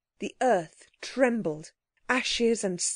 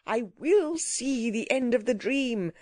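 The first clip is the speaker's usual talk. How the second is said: mildly raised insistent voice; determined cry; 2 semitones higher than usual. A narrator's reading. Gentleman refuses to leave the disaster, with a determined cry. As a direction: insist on it, crying out a little. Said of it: "cried the learned gentleman".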